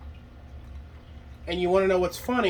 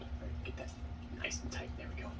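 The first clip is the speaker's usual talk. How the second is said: whispering; 16 dB quieter than usual. Real, not acted. Unvoiced mutter while working.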